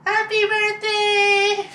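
high, loud, stretched-out cheer; celebratory squealed greeting; excited birthday shout. Real, not acted. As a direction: shout it long and high for the big surprise. Birthday surprise reveal.

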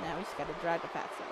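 quick, low and soft; hushed, hurried note to self; muttered aside. Real, not acted. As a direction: think aloud under your voice. Talking through the game move.

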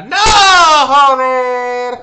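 loud pained cry of frustration; frustrated shout. Real, not acted. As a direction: yell it out as the attempt fails. Failing in game.

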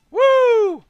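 loud, high woo; high-pitched cheer; excited whoop. Real, not acted. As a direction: let out a big high whoop. Whoops during play.

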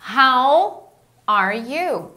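slow, with HOW drawn out long; slow modelling. Shown as a demonstration. Teacher modelling a phrase for students, slow. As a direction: draw out HOW, then pause before are you.

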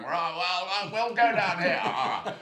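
hammed-up Liverpool local plotting against him; quoting locals who might take against a bright red jumper; mock hostile local. Imagined hostile locals in pub.

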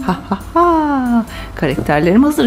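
normal talking voice

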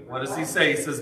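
ordinary delivery

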